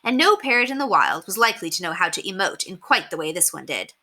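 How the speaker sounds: ordinary delivery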